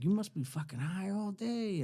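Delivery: high-pitched, lightly voiced amazement; imitated impressed hippie. Hippie to dipping teen, imitated awe. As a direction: pitch it up, marveling.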